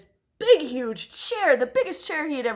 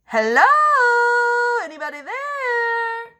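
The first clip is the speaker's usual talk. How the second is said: loud calling hello, then a high anybody there; shouted hello into a big castle; 14 semitones higher, 9 dB louder than usual. A narrator's reading. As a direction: call out into the empty castle. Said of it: "And he shouted".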